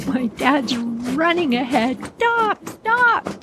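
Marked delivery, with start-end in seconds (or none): 2.2-3.4 urgent cry. Acted. Dad running after the runaway sled, high urgent cry. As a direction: call out twice in acted alarm. Shouted as sled nears lake.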